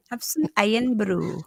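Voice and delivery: slow, hammed-up Scottish accent on Have some Irn-Bru; a half-hearted try at a Scottish voice; bad Scottish accent. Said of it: "I can't do the Scottish".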